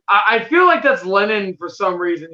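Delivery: usual speaking voice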